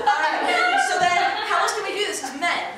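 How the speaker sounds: talking through loud laughter; high, loud, laugh-filled voice. Real, not acted. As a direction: carry on while cracking up. Still laughing at the attempt.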